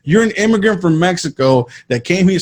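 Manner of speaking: normal talking voice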